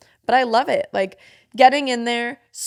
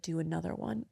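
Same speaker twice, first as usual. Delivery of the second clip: quiet; 4 semitones lower, 12 dB quieter than usual. Retold or imitated. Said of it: "And he's like".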